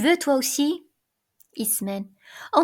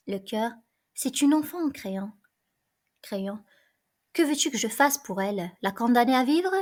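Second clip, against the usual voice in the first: ordinary talk, nothing marked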